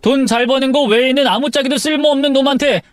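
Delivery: high-pitched, heated taunting; bitterly sarcastic outburst; angry challenge. Acted. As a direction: dare your wife to insult you to your face. Husband taunts his pleading wife.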